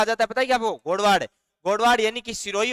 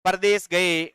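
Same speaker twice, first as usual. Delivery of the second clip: dictation pace; much slower than usual. Shown as a demonstration. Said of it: "यहां पर एक बात और लिखवा देता हूं".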